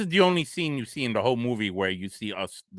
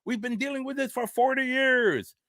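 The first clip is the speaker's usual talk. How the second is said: much higher, strained voice of a fed-up mob; defiant crowd; 14 semitones higher than usual. Retold or imitated. Angry townsfolk answer the sheriff, high defiant voice. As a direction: play the mob refusing to back down. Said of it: "They're like".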